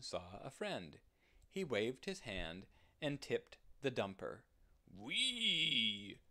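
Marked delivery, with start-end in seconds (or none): 4.9-6.2 high, gleeful pig squeal; excited whee. Acted. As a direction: slide down with a happy whoop. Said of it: "said the pig".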